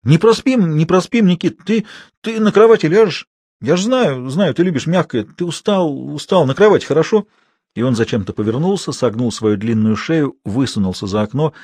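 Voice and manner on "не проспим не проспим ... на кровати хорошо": higher, louder, eagerly fussing. A narrator's reading. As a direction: coax a tired friend to bed. Ilyusha fussing over Nikita. (суетливо уговаривая)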